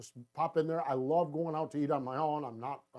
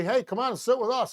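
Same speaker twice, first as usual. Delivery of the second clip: higher, louder friendly call across tables; 8 semitones higher, 5 dB louder than usual. Retold or imitated. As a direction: wave a stranger over to the table. Inviting strangers to sit.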